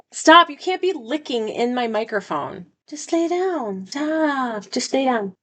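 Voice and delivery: high-pitched, exasperated pleading; scolding the puppy. Real, not acted. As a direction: gently tell off a misbehaving puppy. Scolding puppy licking the microphone.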